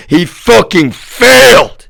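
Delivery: high, slow yell of scorn; angry outburst. Real, not acted. Railing at the man who failed, furious high yell. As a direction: let it rise into a drawn-out, furious yell.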